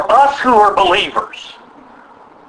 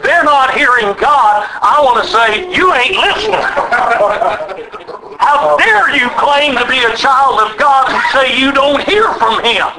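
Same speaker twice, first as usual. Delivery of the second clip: raised, rhythmic preaching to the congregation; 5 semitones higher than usual. Acted. Pastor preaching to his church with raised fervor.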